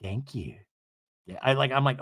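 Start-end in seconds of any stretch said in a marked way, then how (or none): 0.0-0.6 low, very soft mock thanks; understated hushed reply; Meredith imitation. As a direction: murmur it low, imitating her. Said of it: "she goes".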